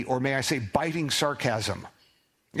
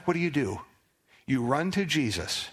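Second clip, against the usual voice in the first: ordinary talk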